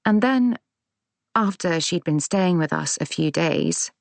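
ordinary delivery

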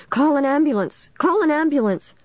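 high-pitched panicked yell; frantic, shrill cry; shrill screaming. A narrator's reading. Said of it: "in a high-pitched, shrill voice".

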